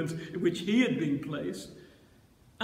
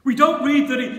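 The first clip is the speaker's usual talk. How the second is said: ordinary delivery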